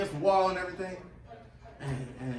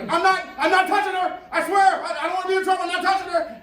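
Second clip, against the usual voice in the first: high, loud frantic protest; panicked denial; 12 semitones higher, 8 dB louder than usual. Retold or imitated. Host protesting his innocence to everyone, high and frantic. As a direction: yelp it out like you're terrified of trouble.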